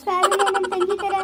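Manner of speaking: slow words through a laugh; amused laugh breaking through the words. Real, not acted. Laughs off the blind mix-up.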